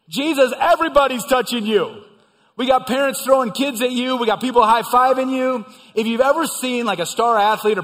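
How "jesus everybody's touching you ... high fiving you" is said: louder, higher, incredulous objection; exasperated loud protest. Acted. Disciples objecting to Jesus, loud incredulous protest. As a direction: protest as if it's obvious.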